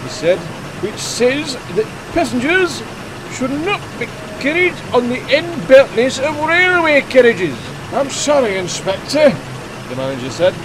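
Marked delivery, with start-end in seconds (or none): 0.8-7.6 stern inspector. A narrator's reading. Inspector citing a bylaw to the quarry manager, stern. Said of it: "said the inspector sternly". 7.9-9.3 higher, louder pleading voice; apologetic manager. A narrator's reading. Quarry manager excusing himself to the inspector, apologetic.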